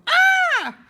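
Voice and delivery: loud high shriek; mother screams in shock; panicked shriek. A narrator's reading. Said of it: "screamed too".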